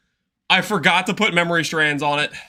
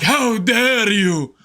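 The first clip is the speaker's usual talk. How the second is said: mock offended seller. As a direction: ham up a pompous offended seller. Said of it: "Some people are like".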